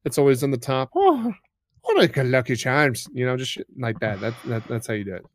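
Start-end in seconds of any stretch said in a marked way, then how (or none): 0.9-3.1 high, loud Lucky Charms leprechaun Irish; cheesy Irish accent. Shown as a demonstration. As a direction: ham it up like the cereal mascot. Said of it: "just try to make it more cheesy".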